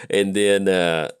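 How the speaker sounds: ordinary talk, nothing marked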